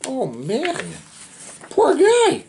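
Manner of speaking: ordinary delivery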